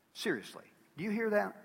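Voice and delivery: ordinary talk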